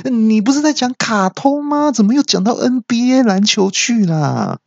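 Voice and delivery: very high-pitched comic outcry; exasperated yell. Acted. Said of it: "然后我就大叫说".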